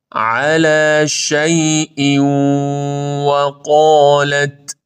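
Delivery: very slow, chanted recitation; Quran recitation. Shown as a demonstration. Teacher reciting the phrase slowly for students.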